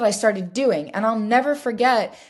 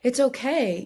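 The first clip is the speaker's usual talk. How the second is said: higher, softened comforting voice; soothing reassurance; 4 semitones higher, 4 dB quieter than usual. Retold or imitated. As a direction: imitate someone gently reassuring a crying client. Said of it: "he was wonderful because he was really".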